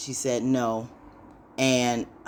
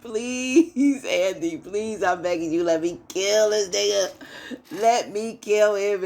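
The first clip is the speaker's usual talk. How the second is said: high-pitched, drawn-out begging, hammed up; mock pleading; 7 semitones higher than usual. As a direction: beg theatrically, imitating a desperate friend. Acts out Fatima begging to attack.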